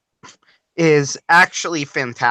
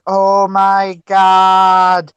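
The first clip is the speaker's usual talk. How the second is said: put-on loud, slow accented exclamation; accented English exclamation; 5 semitones higher, 6 dB louder, much slower than usual. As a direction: stretch it out accented. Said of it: "He says".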